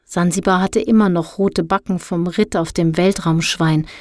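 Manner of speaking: usual speaking voice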